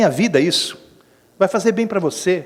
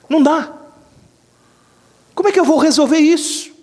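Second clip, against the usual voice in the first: aflição imitada; 9 semitones higher, 5 dB louder than usual. Retold or imitated. Pregador imita fiel desesperado.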